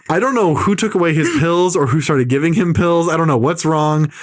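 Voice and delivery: ordinary delivery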